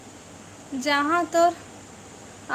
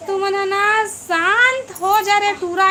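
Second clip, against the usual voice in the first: loud scolding; 4 semitones higher, 5 dB louder than usual. Real, not acted. Streamer telling noisy boys to settle down, loud scolding. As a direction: scold them over the noise, pitched up. Tells noisy children to quiet down.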